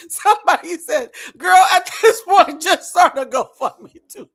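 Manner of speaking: slow, loud, teary laughing read; crying with laughter. Real, not acted. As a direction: read it out while laughing to tears. Reading comment while crying laughing.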